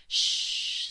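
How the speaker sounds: loud, irritated shh; cross shush. A narrator's reading. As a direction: shush them sharply. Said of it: "said Moonface crossly".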